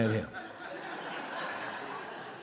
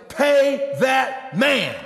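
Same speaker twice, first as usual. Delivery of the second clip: slow, weighty, louder command; emphatic command; 7 semitones higher, 4 dB louder, much slower than usual. Retold or imitated. Pastor quoting God's order, slow and emphatic. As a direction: lay each word down heavy.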